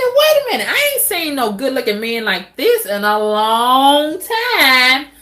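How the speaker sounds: high excited quote of herself; excited retelling. Retold or imitated. Woman retelling to viewers seeing a handsome man, excited. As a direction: replay how thrilled you were.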